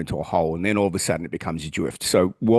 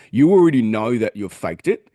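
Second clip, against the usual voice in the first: normal talking voice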